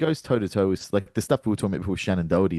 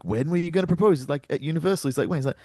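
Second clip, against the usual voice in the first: higher, puzzled line reading; mock-confused delivery, pitched up; confused actor; 6 semitones higher, faster than usual. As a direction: sound lost in your own sentence. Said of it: "he looks confused by his own sentence".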